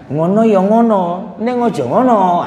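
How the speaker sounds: slow Javanese "Ngono ya ngono" in a sing-song lilt; Javanese saying. Shown as a demonstration. Quoting a Javanese proverb to the crowd, slow Javanese lilt. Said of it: "gitu kan".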